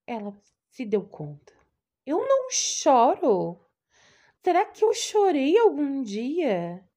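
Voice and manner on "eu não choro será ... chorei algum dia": high, loud and slow, alarmed; desperate realization. Acted. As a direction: cry out the question in dawning panic. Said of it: "E desesperada".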